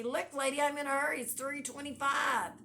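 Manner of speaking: finally losing patience and snapping; snapping at the cashier. Retold or imitated. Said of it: "you just snap and you say".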